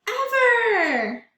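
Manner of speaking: excited exclaiming. Acted. Teacher marvels to the kids at the bear's stuff, excitedly. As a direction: marvel at the pile out loud. Teacher asks then voices boasting bear.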